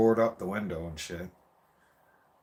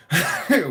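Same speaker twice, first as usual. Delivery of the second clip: burst of laughter; 8 semitones higher, 8 dB louder than usual. Real, not acted. Laughing at the memory.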